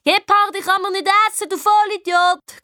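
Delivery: high, exasperated put-down; scornful correction. A narrator's reading. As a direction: set him straight in a high, annoyed voice. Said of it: "korrigiert ihn der Leo". (genervt korrigierend)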